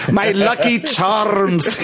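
high, slow mock-Irish leprechaun; botched Irish accent. Shown as a demonstration. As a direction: draw it out in bad stage Irish. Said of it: "Ye, ye old Irish".

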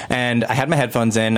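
normal talking voice

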